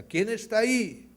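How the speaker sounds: high, loud call into the distance; curious shout. A narrator's reading. Curious boy calls out to the mountain, loud shout. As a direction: call out across the mountains, wondering. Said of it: "Con curiosidad el niño grita".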